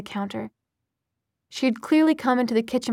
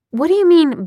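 higher, louder disbelieving question; incredulous demand; 7 semitones higher, 8 dB louder than usual. A narrator's reading. Clary questioning Jace's bond with Sebastian, incredulous. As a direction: challenge it in raised disbelief.

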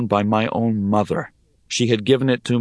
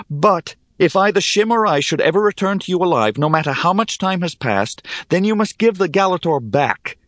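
higher-pitched, earnest insistence; urgent, firm bargaining; insistent; 10 semitones higher than usual. A narrator's reading. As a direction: lay down terms in a raised pitch. Hero states wager terms.

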